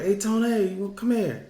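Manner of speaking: high, loud call to a friend; neighbor calling out. Retold or imitated. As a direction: holler over to the neighbor as he heads out. Neighbor calls him over.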